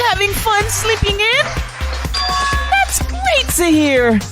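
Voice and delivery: sing-song menace like a game show villain; fighting game announcer. Acted. Plays the Killing Game announcer.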